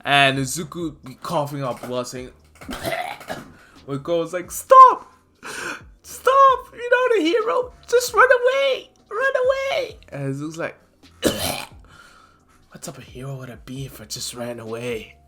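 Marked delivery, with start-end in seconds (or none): 2.6-3.4 soft breathy cough. Acted. Beaten Izuku coughing up blood, breathy. 4.5-10.0 very high, loud crying scream; desperate screaming. Acted. Koda screaming at beaten Izuku to run, crying. 11.2-15.1 softer, strained; weak defiant reply. Acted. Wounded Izuku answering Koda softly.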